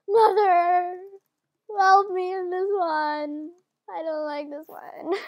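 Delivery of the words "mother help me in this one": high, drawn-out cry for help; loud plea stretching into a slow whine; wailing plea. Real, not acted. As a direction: call out pleadingly, dragging the words. Pleading at a hard map.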